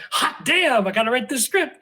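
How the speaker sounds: high-pitched, gung-ho reenactment; excited self-quote. Retold or imitated. Writer recounts his reaction to her offer, playfully excited. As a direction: act out jumping at the job. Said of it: "So I was like".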